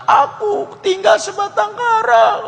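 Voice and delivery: put-on high falsetto of a lonely young woman; crying woman's voice. Woman telling the fighter she is alone, high falsetto. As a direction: squeak it out slow like a helpless girl. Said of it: "Wanita ini bilang".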